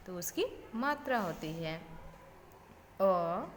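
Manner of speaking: the अ at the end stretched out long; long vowel. Shown as a demonstration. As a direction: draw out the अ. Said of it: "उच्चारण करते time मुझे समय लगता है".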